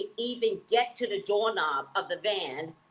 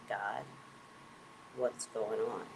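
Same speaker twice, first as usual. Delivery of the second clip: low, soft plea to God; a quiet prayer whispered to Spirit; hushed prayer; 6 semitones lower, 10 dB quieter, slower than usual. Retold or imitated. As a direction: murmur the question to God.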